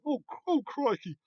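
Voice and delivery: high mock "oh crikey" yelp; startled coppers. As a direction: play a flustered old-fashioned bobby, pitched well up. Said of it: "faces on".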